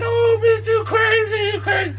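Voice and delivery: very high, squealing mock disbelief; shocked outcry. Onlookers reacting as he comes round the corner, mock shocked outcry. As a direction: cry out loud and high like a stunned onlooker.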